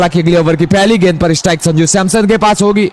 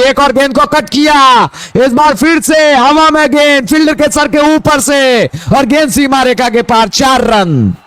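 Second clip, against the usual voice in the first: higher, eager ball-by-ball call; excited cricket commentary; 5 semitones higher than usual. Real, not acted. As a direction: ride the shot to the boundary. Boundary called live. (जोशीली cricket commentary)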